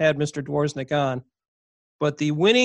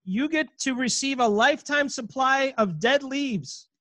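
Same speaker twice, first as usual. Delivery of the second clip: upbeat prize-announcer read, pitched up; game show announcer; 6 semitones higher, 3 dB quieter than usual. Acted.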